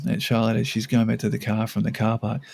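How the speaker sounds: usual speaking voice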